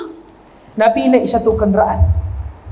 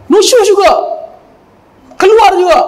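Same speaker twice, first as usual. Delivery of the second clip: mock defiant wife; 6 semitones higher, 4 dB louder than usual. Defiant wife goes out anyway.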